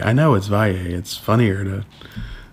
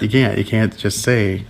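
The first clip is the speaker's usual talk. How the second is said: normal talking voice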